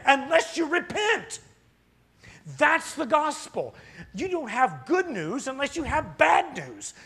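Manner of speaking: loud, slow, high-pitched hellfire sermon; full-force revival preacher warning of wrath; fiery preaching. Real, not acted. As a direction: preach it hard and loud to the pews.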